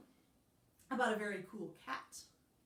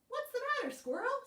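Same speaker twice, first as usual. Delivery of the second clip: much higher, louder curious cat; Pete voice; 4 dB louder, faster than usual. Acted. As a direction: ask it high and concerned. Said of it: "said Pete".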